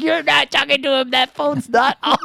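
put-on high, loud child voice, breaking into a laugh; imitating little daughter. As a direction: insist like a know-it-all little girl. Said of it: "And she's like".

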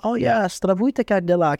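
high, slow acted Russian greeting; drawn-out, high-pitched hello in halting Russian; showing-off greeting. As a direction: play up the foreign phrase, pitched high. Shows off his few Russian words.

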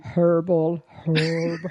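"Herbal" and "herb" with the H sounded; pronounced H. Shown as a demonstration. Guest defending their pronunciation, H sounded in "Herbal". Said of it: "You're pronouncing the H".

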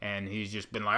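ordinary talk

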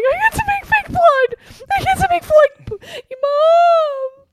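very high, loud tearful wail; mock happy crying. Effects artist imitates crying to mom over blood job, mock sobbing. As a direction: wail it out in hammed-up joyful sobs. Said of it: "I cried to my mom going".

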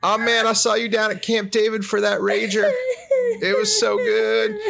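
a chummy president impression cracking himself up; Bill Clinton. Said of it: "Do you think Bill Clinton calls Hammond".

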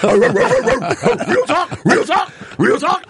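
high, yelped dog-bark words. Retold or imitated. Man voicing his dog in dreams, yelped bark. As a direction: imitate a dog barking the words. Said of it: "It's just my dog".

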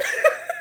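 high-pitched burst of laughter; laughing. Real, not acted. After saying they ran so hard with that, laughing. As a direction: break into a high, real laugh.

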